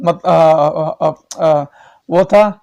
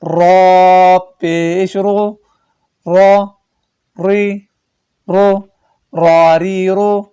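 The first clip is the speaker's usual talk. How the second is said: slow, drawn-out chant of each letter and vowel; qaida recitation; much slower than usual. Shown as a demonstration. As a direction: recite the drill, stretching every syllable.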